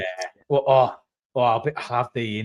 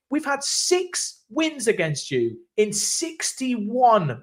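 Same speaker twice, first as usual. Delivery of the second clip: retold comeback; 10 semitones higher, slower than usual. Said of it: "I was like".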